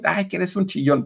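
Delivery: high mocking jeer; teasing taunt. Boy teases his scared friend, high mocking jeer.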